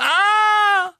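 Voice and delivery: sudden high loud yelp; startled cry. A narrator's reading. Hermione cries out when the twins appear. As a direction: jump at the bang.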